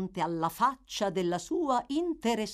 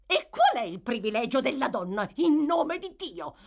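shouting; 6 semitones higher than usual. A narrator's reading. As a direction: yell it at him. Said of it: "urlò la vedova".

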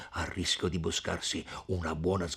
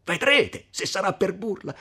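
very high, loud outraged yell; enraged, high-pitched outburst; furious shout; 12 semitones higher, 10 dB louder than usual. A narrator's reading. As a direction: shout it back as a threat. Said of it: "gridò Renzo".

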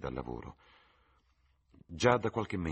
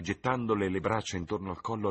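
plain reading voice

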